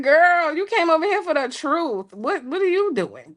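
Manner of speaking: high, mock-stern girl talk to herself; hammed-up scolding voice in her head; scolding inner voice. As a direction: scold yourself like a sister. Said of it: "I was like".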